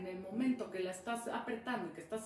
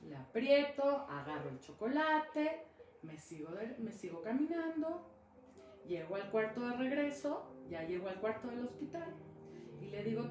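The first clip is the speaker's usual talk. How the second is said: usual speaking voice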